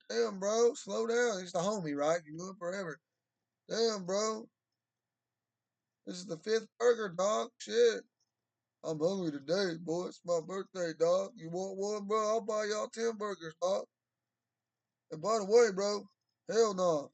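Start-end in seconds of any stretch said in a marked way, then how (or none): 0.1-1.4 mock buddy. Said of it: "you're like". 3.7-17.1 buddy rant. Retold or imitated. Impersonating native drinking buddy.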